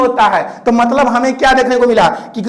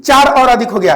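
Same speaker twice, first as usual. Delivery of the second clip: ordinary delivery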